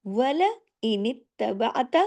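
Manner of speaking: slow, drawn-out tajweed chant; Quranic recitation. Shown as a demonstration. Teacher reciting a verse for students, slow chant. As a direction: recite it slowly with full tajweed.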